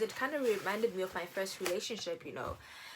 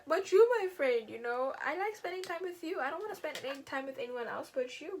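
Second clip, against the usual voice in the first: much higher, whiny plea; high, louder clingy whine to her boyfriend; clingy pleading; 8 semitones higher, 4 dB louder than usual. Retold or imitated. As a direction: beg him like a clingy girlfriend. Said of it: "I was just like".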